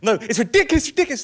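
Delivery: high, loud mock outrage; emotional protest. Passenger arguing with the bus driver, emotional. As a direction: imitate getting worked up and indignant. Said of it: "that's getting really emotional".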